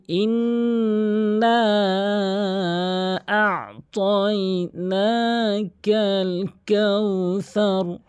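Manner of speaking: proper Quran chant, each vowel drawn out; correct recitation. Shown as a demonstration. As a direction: intone ইন্না and আ'তাইনাকাল long and melodic. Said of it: "আমি একবার তেলাওয়াত করছি".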